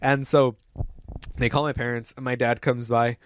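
normal talking voice

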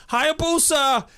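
high, loud sustained call of the name; imitated commentator scream. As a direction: scream it out like a stricken ringside commentator. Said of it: "he screams out".

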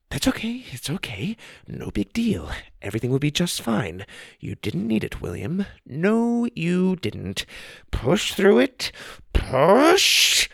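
high, frantic muttering to himself; panicky self-calming. Acted. Wonka calming himself on the bridge, pitched high and frantic. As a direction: talk yourself down, rising into panic.

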